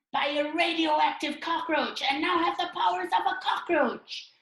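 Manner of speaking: high, loud, slow cartoon voice; challenging comic character. Doubter challenges Bao Man's superpowers, high loud theatrical voice. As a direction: grill the would-be hero with exaggerated theatrical questions.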